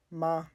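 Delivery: MA held high and level. Shown as a demonstration. Teacher shows the first tone on example syllable, high and sustained. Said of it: "a high sustained tone".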